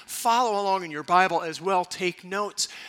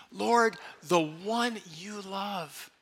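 slow prayerful repeat; 2 semitones higher, much slower than usual. Shown as a demonstration. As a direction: let each word settle. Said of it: "One more time".

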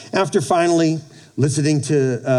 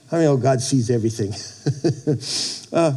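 usual speaking voice

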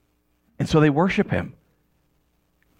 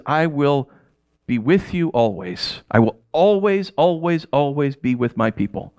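God's promise; 4 semitones lower, slower than usual. Acted. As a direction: promise gently that you will always be there.

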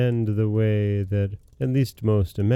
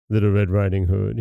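ordinary delivery

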